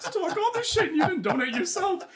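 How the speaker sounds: loud, very high, amused retelling of a rant; indignant telling-off. Retold or imitated. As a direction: tell her off at full volume, half laughing. Retold quarrel, telling her off.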